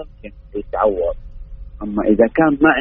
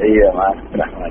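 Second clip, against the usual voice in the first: car speakerphone; 2 semitones lower than usual. Real, not acted. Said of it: "الصوت مو بواضح".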